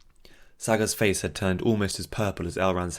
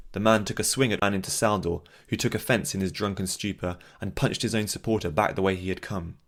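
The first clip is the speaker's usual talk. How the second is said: plain reading voice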